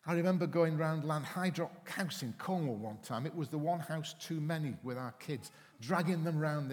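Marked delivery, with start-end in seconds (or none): none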